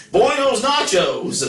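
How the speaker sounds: very high, drawn-out gringo Spanish. Shown as a demonstration. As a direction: joke greeting in an exaggerated slow gringo accent.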